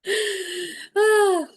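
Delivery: loud, high exhaled sigh; heavy sigh. Real, not acted. Medium overwhelmed by the reading, heavy sigh. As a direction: let out a big voiced sigh.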